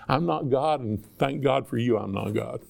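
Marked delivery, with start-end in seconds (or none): 2.0-2.6 softer, dropped to a near hush on "I'm not God"; soft aside. Shown as a demonstration. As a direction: let "God" fall away quietly, almost to yourself. Repeats line, second softer.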